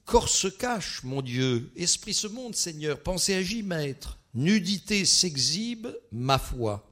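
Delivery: high, loud recitation in spaced pairs; declaimed quiz clues. Shown as a demonstration. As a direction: proclaim each clue like a solemn litany. Reads riddle clues aloud.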